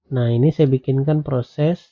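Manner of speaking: ordinary talk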